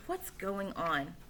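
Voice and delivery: soft and slow; murmured question; quiet slow aside. Acted. Quiet inner voice.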